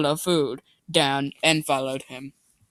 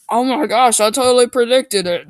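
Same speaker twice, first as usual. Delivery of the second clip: loud, high excited outburst; thrilled she called it; 7 semitones higher, 7 dB louder than usual. Real, not acted. Excited about predicting the plot.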